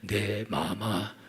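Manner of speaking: slow, soft humble "네, 마마"; bow down like a servant; meek obedient husband. Retold or imitated. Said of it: "그러면 남편이". (순종하는 남편의 느리고 조용한 대답)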